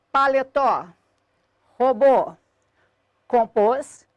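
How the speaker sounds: "paletó, robô, compôs" each punched on its last syllable; final-syllable stress. Shown as a demonstration. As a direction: hit the endings of "paletó, robô, compôs", pausing after each. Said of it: "palavras cuja sílaba tônica é a última".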